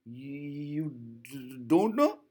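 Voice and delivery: father mimicking the stutter, rising at the end; mock the child's stammer as a question. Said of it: "He is mimicking".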